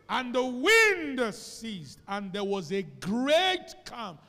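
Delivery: very slow, loud preaching; drawn-out proclamation. Acted. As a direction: stretch each word for effect.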